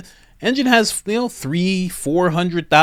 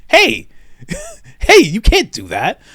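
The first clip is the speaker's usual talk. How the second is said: mock protest; 9 semitones higher, 5 dB louder than usual. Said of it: "it's like".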